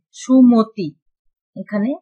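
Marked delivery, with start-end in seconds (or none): 0.1-0.9 raised, loud সুমতি with a clear "mo"; middle vowel as o. Shown as a demonstration. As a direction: punch সুমতি, rounding the ম's vowel to o. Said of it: "সেটা ওকারের মতো হয়েছে". (উঁচু গলায়, ম-কে "মো" করে "সুমতি")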